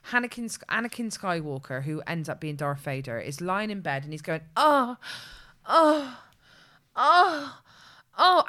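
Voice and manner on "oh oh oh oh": loud, rising mock sex moans on each oh; moaning oh after oh, louder than speech. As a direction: ham up a series of loud moans, pitch climbing. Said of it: "it sounds like he's coming".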